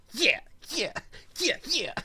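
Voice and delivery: put-on high eager child voice; mock little girl cheering; kid voice. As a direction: chirp it like a punching little kid.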